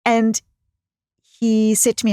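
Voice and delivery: ordinary delivery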